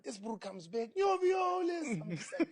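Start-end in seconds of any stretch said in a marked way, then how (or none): 0.9-2.0 high, loud, drawn-out call of his name; eager fan calling. Retold or imitated. Fan coming back to greet him, loud and drawn out. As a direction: stretch the name out like a fan who won't quit.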